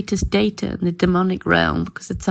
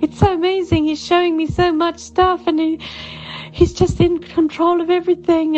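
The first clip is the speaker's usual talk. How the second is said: high, gushing voice of a thrilled daughter; imitating excited daughter; 8 semitones higher than usual. Mum voicing her daughter's news on the phone, high and gushing. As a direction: play the daughter bubbling over with wonder. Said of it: "She said".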